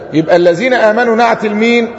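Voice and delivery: usual speaking voice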